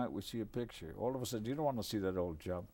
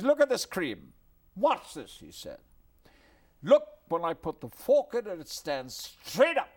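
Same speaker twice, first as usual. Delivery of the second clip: Chaplin showing off; 6 semitones higher, 8 dB louder than usual. Retold or imitated.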